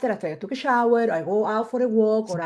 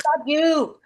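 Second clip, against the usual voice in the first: mild shout; 6 semitones higher than usual. Retold or imitated. Retelling her shouted curse.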